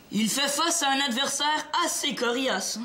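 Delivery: building to a high slow goal shout; mock sports commentary. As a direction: call the game like a play-by-play. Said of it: "pourrais-tu baisser le ton un peu".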